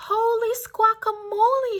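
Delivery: high, excited hen exclamation; high hen squawk of surprise; clucking hen. A narrator's reading. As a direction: cry it out like a flustered hen. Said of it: "she clucked".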